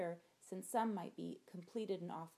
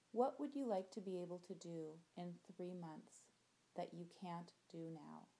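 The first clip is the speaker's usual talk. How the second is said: gentle visualization voice, soft endings; guided meditation; 4 dB quieter than usual. Acted. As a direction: guide them through the imagery calmly.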